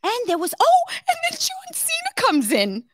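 very high, loud excited outburst; excited squeal. Real, not acted. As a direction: announce it with giddy excitement. Excited retelling of movie.

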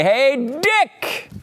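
high-pitched, drawn-out hail; calling out a greeting. Acted. Time traveler walks up to Dick Cheney, exaggerated high greeting.

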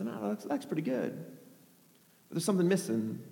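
very soft, as if to himself; hushed, understated appraisal; quiet musing. Acted. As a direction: size up your work under your breath. Elijah musing over his altar.

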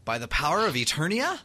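ETERNIA lifted into an unsure question; questioning power cry. Retold or imitated. Host imitating He-Man's oddly asked catchphrase, questioning. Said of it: "It's like it's a question".

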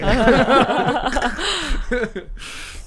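high, loud peal of laughter. Real, not acted. Laughing at the beer joke.

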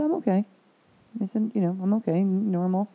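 high-pitched retold insistence on being fine; imitated reassurance. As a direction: lightly mimic yourself assuring the doctor. Retold answer insisting she is fine.

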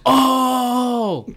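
crowd oh. Retold or imitated. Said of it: "everyone goes".

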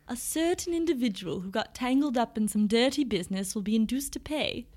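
mock English TV detective accent. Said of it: "He said it with an English accent".

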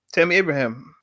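ordinary talk, nothing marked

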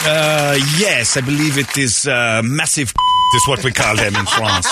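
slow mock French accent; hesitant hammed-up French athlete; Victor Wembanyama. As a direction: explain haltingly in a French accent. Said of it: "Is there a French word".

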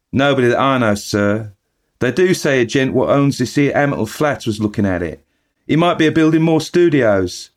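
chatty eager bellboy reporting the news; Cockney bellboy. Acted. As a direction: Thomas the bellboy gossiping to his master. Bellboy Thomas answers.

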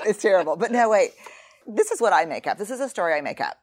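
ordinary talk

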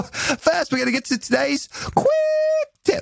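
very high, hammed-up British accent. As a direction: squeal it in a mock British voice. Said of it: "I said that in a British accent".

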